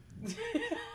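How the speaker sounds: high-pitched burst of laughter. Real, not acted. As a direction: crack up in a high giggle. Group cracks up at joke.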